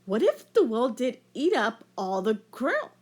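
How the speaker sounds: plain reading voice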